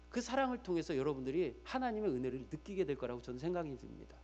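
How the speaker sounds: ordinary talk, nothing marked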